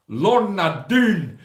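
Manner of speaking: slow, stretched, high mock Scottish; playful Scottish-ish name call. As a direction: drawl the name in a put-on Scottish lilt. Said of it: "I automatically go".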